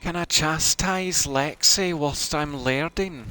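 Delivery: high, slow Scottish laird talk; Scottish accent. Pretend. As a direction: draw it out in put-on Scots. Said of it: "her accent from that show".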